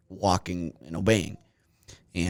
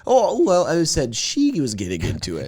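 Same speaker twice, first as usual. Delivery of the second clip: defensive; 8 semitones higher, 5 dB louder than usual. Real, not acted.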